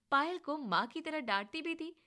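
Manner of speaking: ordinary delivery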